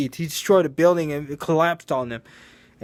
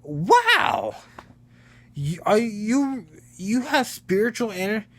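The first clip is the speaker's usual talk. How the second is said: high-pitched amazed "Wow!"; 7 semitones higher than usual. Retold or imitated. Goku amazed by Kuwabara's spiritual energy, excited high voice. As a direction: gush with wide-eyed wonder. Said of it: "fucking amazed".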